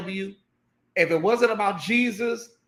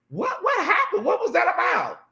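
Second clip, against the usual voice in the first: much higher, baffled mock question; confused chat voice; 10 semitones higher than usual. Host voicing confused viewers in the chat, baffled. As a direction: play a puzzled viewer asking what just happened.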